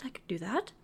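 unvoiced whispered repeat. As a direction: whisper it to yourself.

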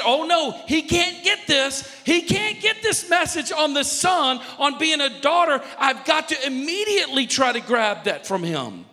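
high, loud, urgent protest; alarmed Satan. Acted. Satan rushing to grab the message away, loud alarm. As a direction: jump in high and forceful, easing down at the end. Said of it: "Satan has to jump in there".

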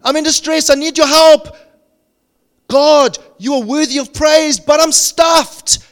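high, loud pleading cry; desperate cry to God. Acted. Preacher voicing David's prayer in distress, loud desperate cry.

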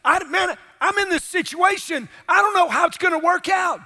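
high-pitched mock protest; protesting to the pastor. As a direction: object like a worried churchgoer. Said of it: "We say, "But, but Pastor".

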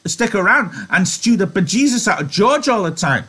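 high-pitched, exasperated tirade; high, sharp character scolding. A narrator's reading. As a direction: lay into him sharply in a high voice. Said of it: "she said sharply".

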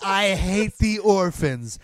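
slow, deliberate defiant repeat; mock stubborn villain; slow defiance. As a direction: draw it out, daring him. Retold defiant repeat in standoff.

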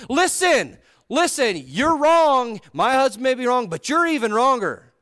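loud, high mock telling-off; confrontational scolding. Wife imagined confronting the future king, hammed-up scolding. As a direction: act out an indignant wife laying into him. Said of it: "She didn't come in there and go".

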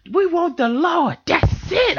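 put-on very high, drawn-out girlish plea; little girl's angry prayer. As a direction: imitate a small girl demanding the Lord, slowly. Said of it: "This girl is angry".

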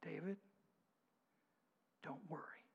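soft, hushed reassurance in David's ear; low comforting whisper; whispering in his ear. Acted. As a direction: breathe it into his ear to calm him. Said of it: "whispering in David's ear".

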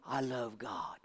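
hushed, breathy lip-service; mock pious whisper. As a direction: whisper it like an empty claim. Mimicking empty claim of loving God.

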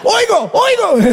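yelling that he can hear; excited shout. Retold or imitated. Healed man shouting to the pastor in joy.

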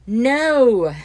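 loud, high flat denial; emphatic no. Acted. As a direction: blurt it together, emphatic. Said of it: "said in unison".

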